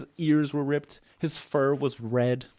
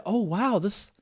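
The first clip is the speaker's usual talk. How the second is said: amazed Peter; 8 semitones higher than usual. Acted. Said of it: "And Peter said".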